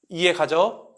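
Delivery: ordinary talk, nothing marked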